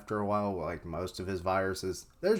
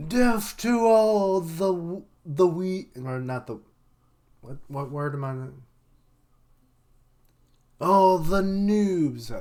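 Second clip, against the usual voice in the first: game show villain; 8 semitones higher, 6 dB louder than usual. Retold or imitated. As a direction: boom it like an evil host. Queen's game show announcement.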